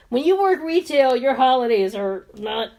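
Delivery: normal talking voice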